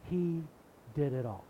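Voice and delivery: usual speaking voice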